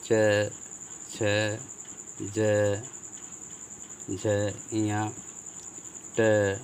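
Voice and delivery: each consonant said slowly and separately, with long gaps; a teacher reciting the consonant rows to students, slowly; slow letter recitation. Shown as a demonstration. Teacher reciting क ख ग one letter at a time to the class, slow. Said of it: "जैसे देख लीजिए".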